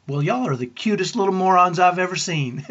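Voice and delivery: put-on almost yell it in a playful Southern accent; deep Southern near-yell. Wife cheering up crying twins, Southern accent, almost yelling. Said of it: "in her deep Southern accent, she almost yelled".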